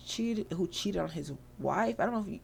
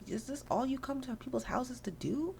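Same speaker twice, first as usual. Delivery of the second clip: high, exasperated mock complaint; fed up; 7 semitones higher than usual. Homegirl tries to stop the mischief, fed up. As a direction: act out an exasperated homeowner, pitched up. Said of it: "She's, like, fed up".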